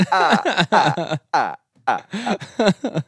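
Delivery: laughs at his own joke, high and loud; high loud cackle. Real, not acted.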